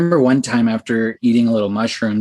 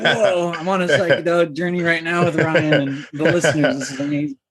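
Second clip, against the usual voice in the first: excited; 5 semitones higher than usual. Retold or imitated. Excited quote about the journey.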